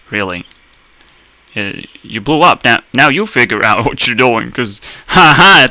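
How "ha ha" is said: loud, slow "ha ha"; pointed, derisive laugh; mocking laugh. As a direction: laugh at them in mockery. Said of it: "that's why we're laughing at you".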